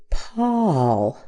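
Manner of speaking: Paul with an open ah as in John. Shown as a demonstration. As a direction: say Paul with the ah of Sean. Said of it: "We have that same ah sound here".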